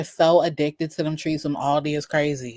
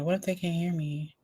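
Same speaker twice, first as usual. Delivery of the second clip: ordinary delivery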